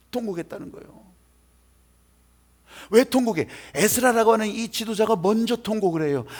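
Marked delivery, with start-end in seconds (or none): 0.1-1.1 softer, falling repetition; hushed echo. Real, not acted. Preacher repeats to congregation that they wailed, in a hushed echo. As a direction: let it drop away quietly.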